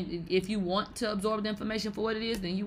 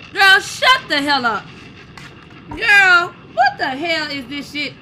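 loud, high exasperated yell; loud outburst; 7 semitones higher, 11 dB louder, slower than usual. Real, not acted. Yelling at the music video in disbelief. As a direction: blast it out in disbelief.

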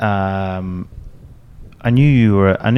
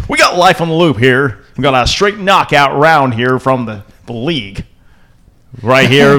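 a hint of a loud American accent, pitched up; 4 semitones higher, 4 dB louder than usual. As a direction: hype it up like a loud American bro. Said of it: "When you guys do American voices".

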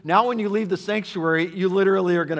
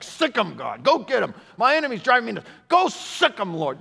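high, hammed-up cry to God; mock-frantic plea, like siccing a dog; psalmist urging attack; 7 semitones higher, 4 dB louder than usual. Mimics psalmist urging God on enemies.

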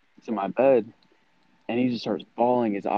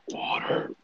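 softer, whispery imitation of the child; creepy deep voice; 6 dB quieter than usual. Telling how the daughter does it all the time, whispery creepy imitation. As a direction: half-whisper it, mock demon kid. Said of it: "Like, she'll be like".

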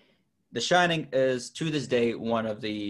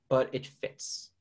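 ordinary delivery

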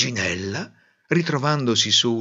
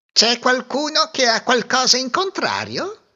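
high, prickly mouse daring anyone to object; a touchy little bully; touchy challenge; 12 semitones higher than usual. A narrator's reading. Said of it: "con aria permalosa". (sfida permalosa, voce acuta)

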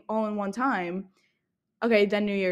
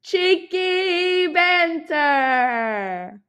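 high, breathy pop-star voice; [name] impression; 8 semitones higher, 6 dB louder, much slower than usual. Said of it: "Do an [name] impression".

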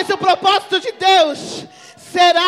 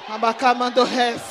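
speaking in tongues; 5 semitones lower than usual. Real, not acted.

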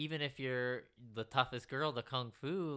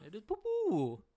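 high, loud mimicry; hammed-up childish declaration; mock verdict; 11 semitones higher, 6 dB louder than usual. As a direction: proclaim it high and silly.

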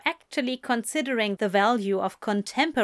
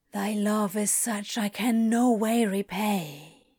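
on a breath. A narrator's reading. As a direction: let it out on a sigh. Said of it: "Crowley said on a breath".

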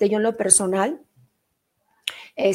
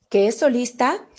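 ordinary talk, nothing marked

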